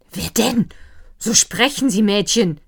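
bully her into answering; snapping. A narrator's reading. Herr von Wallbaum barks at maid Lina. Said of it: "herrschte Herr von Wallbaum sie an".

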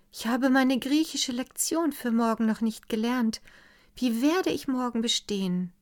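sighing it up to him, a little helpless; Daniela's plea. A narrator's reading.

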